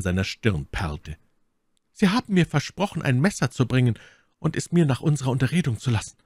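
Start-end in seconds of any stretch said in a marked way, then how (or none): 1.9-6.2 higher, then rushed reminder; lightly voiced insistent woman; insistent lady. A narrator's reading. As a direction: press him to keep his promise. My Lady presses Felton.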